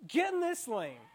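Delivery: mock furious driver yelling; road rage shout. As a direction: bark it at the other driver.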